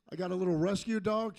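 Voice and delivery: ordinary talk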